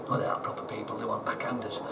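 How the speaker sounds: hushed, conspiratorial correction; whispered aside. Retold or imitated. Telling the listener about canal officials, whispered aside. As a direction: drop to a whisper for the dig.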